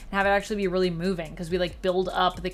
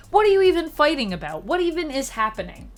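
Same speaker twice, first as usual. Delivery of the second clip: high, loud baffled questions; incredulous, pitched-up outburst; exasperated disbelief; 8 semitones higher, 5 dB louder than usual. Acted. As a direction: throw up a mock protest. Mock protest at pointless fight.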